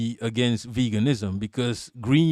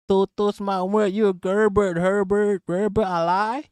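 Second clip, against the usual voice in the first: high put-on Canadian voice; hammed-up Canadian impression, pitched up; mock Canadian voice; 7 semitones higher than usual. Teasing the Canadian guest with a high put-on Canadian voice. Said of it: "We're talking accents".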